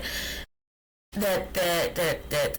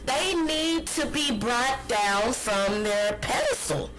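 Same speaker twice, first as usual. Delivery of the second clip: ordinary delivery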